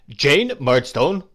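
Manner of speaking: loud, slow thundering command. Acted. Brother thundering at his sister to be silent. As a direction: thunder her name to silence her. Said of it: "thundered Mister Murdstone".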